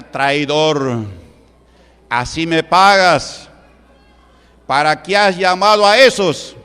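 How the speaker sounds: loud, slow, accusing devil voice; angry devil. Retold or imitated. Devil scolding the brujo for calling the church, loud and angry. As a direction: berate the traitor who called the preacher. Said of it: "Así le decía el diablo".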